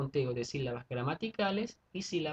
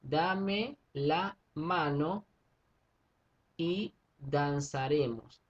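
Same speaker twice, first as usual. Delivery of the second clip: very slow syllable count; much slower than usual. Shown as a demonstration. Teacher spells out verse syllables for class, very slow. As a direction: stretch every syllable apart for counting. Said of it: "Fíjense que aquí tenemos".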